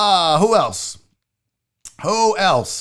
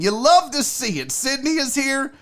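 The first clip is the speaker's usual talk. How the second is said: ordinary delivery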